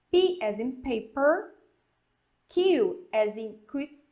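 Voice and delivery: "Q as in quit" with a clear English Q; teacher modelling letter names; English spelling drill. Shown as a demonstration. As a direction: spell it out slowly for the class. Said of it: "vamos dar uma soletrada aqui".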